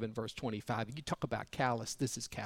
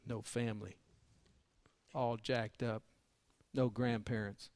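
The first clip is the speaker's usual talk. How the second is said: hushed and grave; 4 semitones lower, 7 dB quieter than usual. Real, not acted. Grave point about abandoned children.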